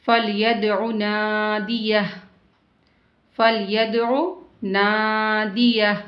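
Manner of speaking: slow, drawn-out tajweed recitation; measured Quranic recitation. Shown as a demonstration. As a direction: chant each word slowly, stretching the vowels. Teacher recites Quran verses.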